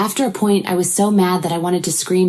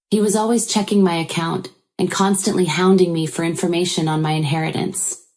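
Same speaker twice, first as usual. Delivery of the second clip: ordinary delivery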